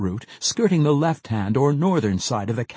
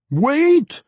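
high, loud canine yelp of a word; yelping scream; 7 dB louder than usual. A narrator's reading. Mage screams at the dwarf with a dog-like yelp. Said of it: "he screamed at Pwent".

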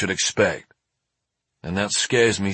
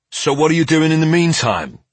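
raised, pointed curt question; higher-pitched, curt challenge; 6 semitones higher, 4 dB louder than usual. A narrator's reading. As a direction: demand it with barely contained impatience. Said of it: "unable to keep the curtness from my tone".

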